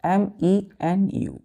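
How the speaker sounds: letters spelled out slowly; dictating letters slowly to the class; slow spelling. Shown as a demonstration. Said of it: "हमने बोला".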